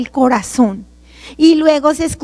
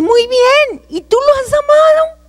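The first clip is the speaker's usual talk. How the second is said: very high, sustained otherworldly voice; questioning heavenly voice; 12 semitones higher than usual. Retold or imitated. Heavenly voice testing her love of God, high and sustained. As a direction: lift it high and hold the last word.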